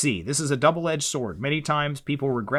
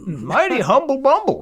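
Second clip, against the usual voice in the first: hammy cartoon voice; 11 semitones higher, 5 dB louder than usual. Echoing cartoon line.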